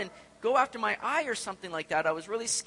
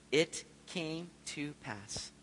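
slow reverent reading; 4 semitones lower, 8 dB quieter, much slower than usual. Acted. Savoring a Bible phrase.

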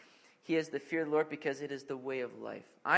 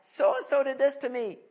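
put-on higher, louder whiny child voice; tattling kid; 9 semitones higher, 8 dB louder than usual. Child complaining to dad about a sibling, whiny and loud. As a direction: play the kid telling on a sibling. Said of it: "one might come to me and say".

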